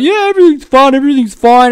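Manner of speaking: high, falsely cheerful brush-off; mock breezy denial. Host parodying someone hiding feelings in breezy denial. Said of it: "'Cause if you just be like".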